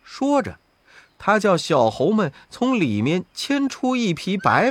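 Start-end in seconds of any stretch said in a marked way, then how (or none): none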